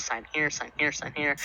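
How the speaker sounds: low, soft, repetitive form-signing patter; barmaid. Acted. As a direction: murmur routine signing directions. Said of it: "she goes".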